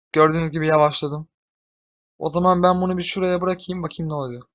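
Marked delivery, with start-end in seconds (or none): none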